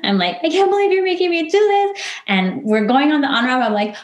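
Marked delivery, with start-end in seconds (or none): 0.4-2.0 high, fast retold outburst at the therapist; retold upset protest, much higher and quicker; lashing out. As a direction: blame her for forcing you, mock-whining. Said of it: "lashed out at her in the car".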